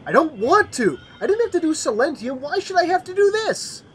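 whining. Acted. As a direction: whine like a kid dodging chores. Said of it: "stop whining".

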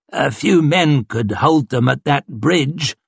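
slow, drawn-out officer's musing; pondering colonel. A narrator's reading. Colonel proposes holding the bridge, slow pondering. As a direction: muse it slowly. Narrator voices Colonel Polder.

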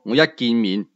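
plain reading voice

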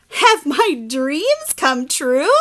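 slow, awestruck; awed wonder. Acted. Pigeon hoping his dream came true, slow awe. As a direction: draw it out in wonder.

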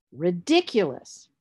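RIDICULOUS slowly, as ri-DIC-u-lous; stress on second syllable. Shown as a demonstration. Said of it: "the stress will be on the third from the end".

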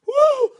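high whooping yell; excited whoop. Real, not acted. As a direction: let out a big whoop. Whoop after the pull.

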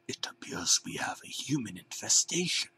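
eerie creature whisper. A narrator's reading. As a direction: hiss it like a hidden banana. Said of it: "B two said".

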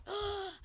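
sharp, high delighted gasp; a quick gasp of glee; surprised gasp. Real, not acted. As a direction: gasp at the find. Finds loot in game.

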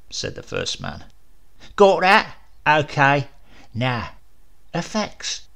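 a lightly voiced official reading a list; first man. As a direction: rattle off the inventory. First man lists Ray's effects.

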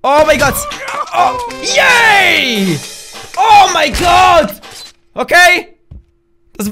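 ordinary delivery

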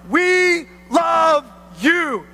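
slow, drawn-out declaration; emphatic proclamation. Real, not acted. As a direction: proclaim it word by word, stretching each one. Preacher proclaims message to community.

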